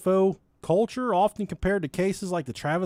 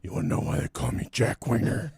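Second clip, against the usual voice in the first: deep mock voice; 10 semitones lower than usual. Mock creepy alien line.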